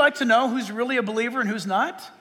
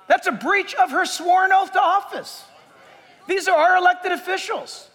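indignant preaching; 6 semitones higher than usual. Real, not acted. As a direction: preach it with outrage. Preacher denouncing politicians.

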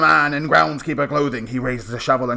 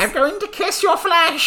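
sweet granny voice; 14 semitones higher than usual. Acted. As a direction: coo it like a sweet old granny. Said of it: "Get outta here, Grandma".

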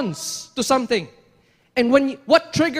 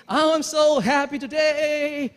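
slow, drawn-out mock cheer; mock happy; 3 semitones higher than usual. Preacher acting an absurd reaction to near disaster, mock happy. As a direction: gush with put-on happiness, dragging it out. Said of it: "I don't think your emotion would be".

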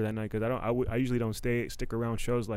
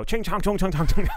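a loud, high caricature of Chinese sounds; 8 semitones higher, 9 dB louder than usual. Retold or imitated.

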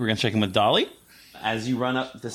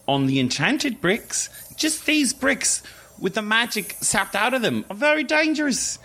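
Professor Pumpkin; 7 semitones higher than usual. Acted. Professor leans down to reassure students, high and careful. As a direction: explain carefully in a high, kindly voice. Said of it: "Professor Pumpkin".

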